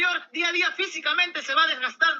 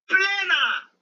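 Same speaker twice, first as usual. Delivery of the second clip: drawn-out, higher-pitched cry of triumph; emphatic punchline; 5 semitones higher, 3 dB louder than usual. Real, not acted. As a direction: hurl the word out, stretched long. Climax of debate argument.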